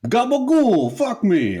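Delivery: a breathy come-on in thick New York; New York accent. Pretend. Partner in bed, hammed-up New York accent. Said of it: "that distracting New York accent".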